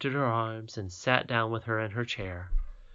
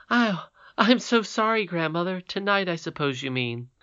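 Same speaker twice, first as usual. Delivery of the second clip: put-on higher, remorseful young princess; sorry little girl; 7 semitones higher, 5 dB louder than usual. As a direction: say sorry to grandmother. Irene apologises.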